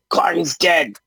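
high-pitched goblin proclamation; cheering goblin. Acted. Goblins acclaim their new leader, high cheering voice. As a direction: pipe it up as a goblin underling.

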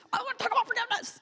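squeaky high mock-holy voice, fast; mock sweet forgiveness. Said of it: "you're feeling angry inside".

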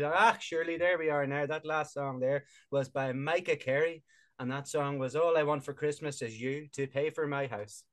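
put-on a lightly done Donegal radio DJ accent; half-hearted Donegal DJ patter; bad Donegal accent. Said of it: "I don't do a good Donegal accent".

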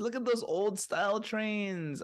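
teasing fans with a high, breathless mock gush. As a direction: gasp over old trains like an overexcited fan. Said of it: "They're like".

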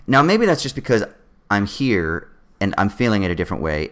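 ordinary talk

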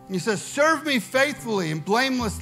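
loud preaching over music; booming preaching. Acted. Preaching at full force.

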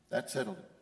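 quietly echoing the refrain. Acted. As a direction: let it fall to a hush. Refrain repeated, dropping away.

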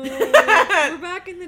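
real laughter over the show intro; laughing. Real, not acted. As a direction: try the intro while cracking up. Retakes intro while laughing.

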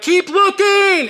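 high, slow, drawn-out brush-off; imitating the host. Host to the starving man, slow mock-patient and high.

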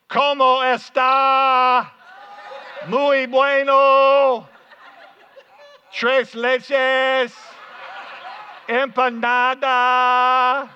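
high, slow shout at a foreigner; talking really loud. Pretend. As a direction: bellow each phrase, dragging out the last vowel. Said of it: "so you talk really loud".

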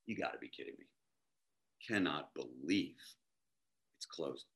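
very low, hushed, deflated mumble; quietly stunned, to yourself; muted disbelief. Retold or imitated. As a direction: let it drop low and soft, slowing in disbelief. Finds the bakery closed after long trek.

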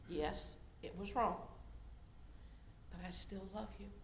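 very soft, hushed comforting words; gentle consoling God voice; tender whisper. Acted. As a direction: whisper it like holding someone close. God comforting after she fails.

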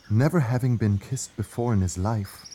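plain reading voice

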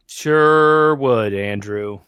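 loud, drawn-out sarcastic agreement. Real, not acted. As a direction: lay the sarcasm on thick.

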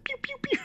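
very high toy zaps; imitated laser gun. Mimicking rip-off laser tag guns, high-pitched pews. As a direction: squeal out quick toy-gun shots.

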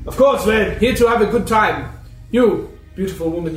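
loud, high and showy charmer; flirty host. Acted. John inviting a woman to sit, loud flirty charm. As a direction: lay on the charm loudly. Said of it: "he's just like".

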